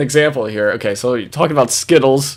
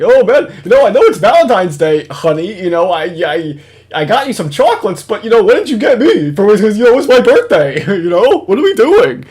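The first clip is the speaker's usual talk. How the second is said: loud, high-pitched self-centred complaint; mocking whine; 6 semitones higher, 5 dB louder than usual. Host mimicking Jim wanting a birthday present, mocking whine. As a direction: imitate a needy partner fishing for a gift.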